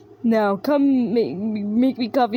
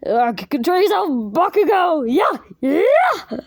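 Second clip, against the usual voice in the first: loud, higher shouting, over the top; overacted anime dub; 7 semitones higher, 6 dB louder than usual. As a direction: yell it like a hammy dub.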